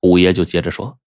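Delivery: plain reading voice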